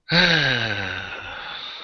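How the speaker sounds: long, exasperated exhale; heavy sigh. Real, not acted. Host lamenting the botched patch order, heavy sigh. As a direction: let out a long sigh of frustration. Sighs over patches lacking Velcro.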